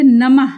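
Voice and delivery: नमः said loudly, ending on a clear breathy ह after the म; visarga demo. Shown as a demonstration. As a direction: stretch नमः out so the final aspirated ह is heard. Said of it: "इससे कुछ बनने वाले शब्द देखिए". (नमः को ज़ोर से, अंत में ह की आवाज़ के साथ)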